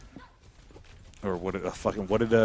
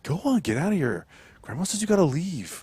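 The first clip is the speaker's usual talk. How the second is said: movie quote impression; 8 semitones higher, 5 dB louder than usual. Quoting movie line back.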